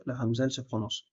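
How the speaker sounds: ordinary delivery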